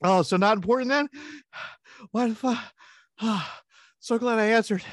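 high, huffing mock annoyance; out of breath. Winded man regretting he took the call, mock breathless exasperation. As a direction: pretend to pant between irritated phrases. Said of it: "But then I'm out of breath".

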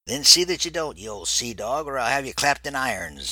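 high, loud hammed-up pirate captain threat; mock pirate captain. Phelps playing along, loud mock pirate threat. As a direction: bellow it like a mock ship's captain. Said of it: "she responded in the same tone".

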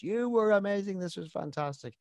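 lightly voiced fan, high then trailing off; a hint of a flattering Welshman; gushing fan. Retelling a hotel guest's praise, lightly voiced and gushing. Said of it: "one of these fellas was giving me all of this".

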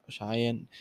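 plain reading voice

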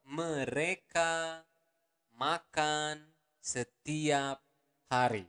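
every word slow and spaced; slow teaching pace. Shown as a demonstration. Teacher to learners, slow model. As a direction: lay each word out for learners. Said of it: "teacher models slowly".

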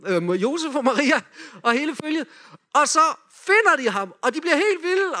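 excited storytelling. A narrator's reading. Leader narrating the joyful find with mounting excitement. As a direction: tell it like a wild celebration.

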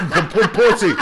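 high, loud laughing stammer; mock junkie who can't get the word out; stuttering through laughter. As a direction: choke on the word while laughing. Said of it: "he couldn't even say".

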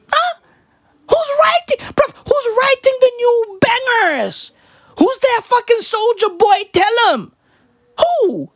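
pitched far up, near-crying squeal; high exasperated rant. Acted. Comic begging to know who writes church bangers, high squeal. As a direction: squeal the questions high and desperate.

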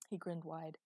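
usual speaking voice

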